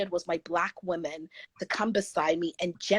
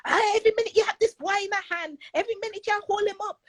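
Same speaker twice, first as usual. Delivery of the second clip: put-on fast, high Jamaican-accented scolding; mock her Jamaican mother's fussing, quick and high; 13 semitones higher, much faster than usual. Jamaican mother scolding her for holding the boy, in fast high Jamaican accent. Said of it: "my mother who's Jamaican".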